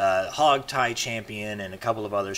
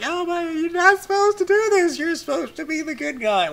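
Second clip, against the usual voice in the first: much higher, a put-on wailing plea; mock crying plea; 4 dB louder than usual. Imitating Shannon crying at G-Man, a high wailing plea. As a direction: whine it like someone crying at a friend. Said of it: "Shannon's just crying".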